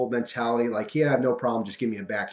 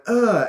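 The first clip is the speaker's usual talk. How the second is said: retold pained grunt; 11 semitones higher, 8 dB louder than usual. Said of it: "because he got burned, he went".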